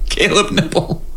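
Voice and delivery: giggling the name out; laughing. Real, not acted. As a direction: laugh through the words. Laughing while repeating the name.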